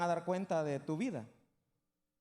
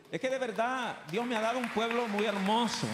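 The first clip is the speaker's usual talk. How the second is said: excited praise; 5 semitones higher, 6 dB louder than usual. Real, not acted. Praising his congregation.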